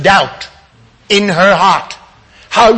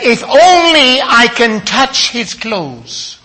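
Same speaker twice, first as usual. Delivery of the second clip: higher, slow, drawn out on "only"; emphatic quote; 4 semitones higher, slower than usual. Retold or imitated. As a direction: proclaim her hope, stretching "only". First take of the woman's words.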